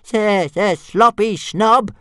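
high, loud, slow dragon taunt; calling out. A narrator's reading. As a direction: call the insult out in a stuttering dragon voice. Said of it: "when Toothless called out".

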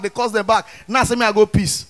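ordinary delivery

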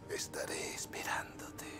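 menacing whisper. Acted. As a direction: breathe it out like a threat. Villain whispering threat.

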